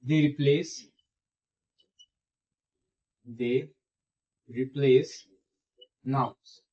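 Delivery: word-by-word dictation with long gaps. Shown as a demonstration. Teacher has students write down the use, halting dictation. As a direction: leave time to write after each word. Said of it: "इसे लिख लीजिए".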